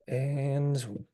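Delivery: hoarse, strained voice; losing voice. Real, not acted. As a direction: voice giving out. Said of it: "sorry, I'm losing my voice".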